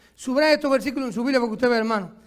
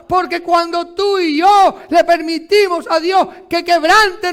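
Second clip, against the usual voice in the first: loud, slow, shouting sermon; full-force preaching; 5 semitones higher, 6 dB louder, slower than usual. Acted. Pastor exhorting congregation to brokenness, loud shouted preaching. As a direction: preach it, lean on every word.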